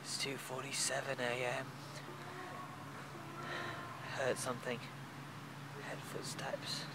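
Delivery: very soft night whisper. Acted. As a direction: whisper so nothing hears you. Night in camp, hearing footsteps.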